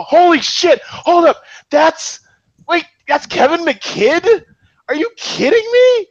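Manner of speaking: high, loud, stunned disbelief; real astonishment. Real, not acted. Host suddenly recognizes actor Kevin McKidd, astonished. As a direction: exclaim as the recognition hits you.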